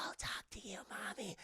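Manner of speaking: put-on whispered, eerie child's voice; imitating a creepy daughter whispering to mom. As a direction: whisper it like a haunted kid. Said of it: "She's like".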